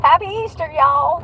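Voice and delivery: upbeat holiday hello; cheery greeting. Real, not acted. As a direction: greet them with a lift.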